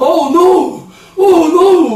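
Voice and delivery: high, loud comic yelp; panicking American. Acted. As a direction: wail in hammed-up alarm as an American. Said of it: "미국 사람이 뭐라 그러는 거야". (과장되게 비명 지르는 미국인)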